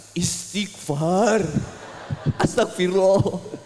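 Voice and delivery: slow, strangled mock gasp of prayer; choked voice. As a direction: force it out as if being strangled. Choked husband at wife's labour. (suara tercekik yang dilebih-lebihkan)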